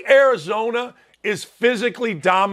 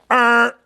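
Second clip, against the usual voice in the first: loud, higher wrong-answer buzzer; imitated buzzer; 4 semitones higher, 5 dB louder than usual. Host marking his own wrong bracket pick, buzzer noise.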